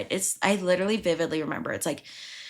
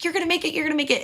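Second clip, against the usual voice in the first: high cheering encouragement; 9 semitones higher, much faster than usual. Retold or imitated. Friend texting encouragement.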